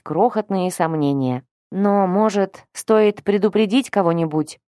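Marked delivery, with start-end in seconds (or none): none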